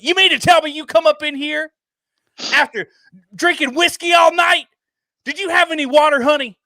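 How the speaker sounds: a hammed-up outraged nurse scolding a patient; scolding phlebotomist. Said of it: "She was just like".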